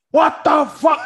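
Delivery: high, loud mock shout; angry father shouting. Father yelling down the phone about the bill, loud. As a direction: yell it like a furious dad on the phone. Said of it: "angry phone call".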